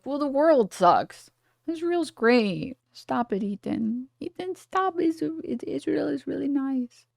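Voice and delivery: high, soft, drawn-out mock pleading; Hila, defensive. Mimicking Hila defending Israel to Ethan, mock defensive. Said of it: "Hila get defensive and she's like".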